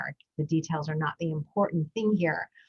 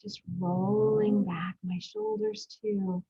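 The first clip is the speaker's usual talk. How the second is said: guided breathing. Acted. Podcast host guiding parents through breathing, in a calm meditation voice.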